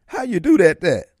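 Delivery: high, loud, excited imitation of a rapper's catchphrase; Master P impression. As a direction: call it out high like Master P hyping a crowd. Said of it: "As Master P would say".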